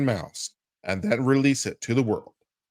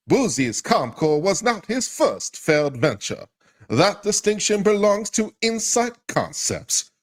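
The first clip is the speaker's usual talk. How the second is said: higher, pumped-up announcer read of the release; mock press-release hype; 7 semitones higher than usual. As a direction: sell it like a cheesy promo to mock it. Reads hyped press release mockingly.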